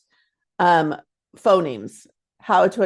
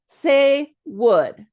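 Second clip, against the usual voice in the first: slow clear prompt; 4 semitones higher, 4 dB louder than usual. Shown as a demonstration. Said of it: "that is so wrong".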